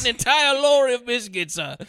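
high, loud British indignation; hammed-up British voice; mock British accent. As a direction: exclaim like a cartoon Brit. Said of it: "I'm British".

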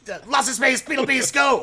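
loud, high, laughing speed pitch; rushed excited pitch. Real, not acted. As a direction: blurt the pitch as fast as possible. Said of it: "each try and do the fastest pitch".

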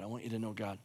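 very soft tender ending; near-silent plea. Acted. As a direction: let it fade to almost nothing. Repeats line, fading each time.

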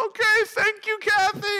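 high, overjoyed sing-out; giddy thanks. Retold or imitated. Student thanks administrator for bad news, giddy thanks. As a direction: act blissed-out gratitude, pitched high.

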